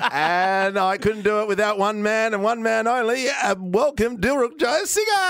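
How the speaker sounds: announcer. Acted. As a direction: build up to the name. Introducing cohost showman style.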